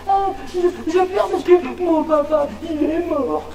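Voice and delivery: put-on high, slow little boy; mild mock sob as a small boy; child crying. Jonas tells the teacher his dad died, lightly crying child. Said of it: "Jonas se met à pleurer".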